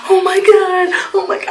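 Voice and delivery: high-pitched mock panic; freaking out. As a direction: squeal it as if losing your nerve. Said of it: "And I'm freaking out".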